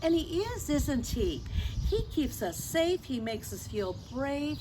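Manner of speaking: ordinary delivery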